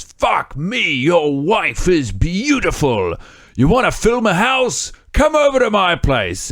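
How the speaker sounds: high-pitched, drawn-out British eccentric; thick British-French accent. Acted. Podcaster imitates British islander inviting couple over, hammed-up British accent. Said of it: "very thick British accent inside of his French accent".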